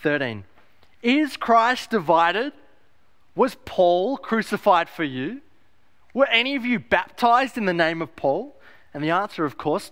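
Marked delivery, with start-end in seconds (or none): 1.0-8.5 higher, incredulous rhetorical questions; mock-questioning, pitched up with punched key words; sarcastic. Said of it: "I love Paul's sarcasm".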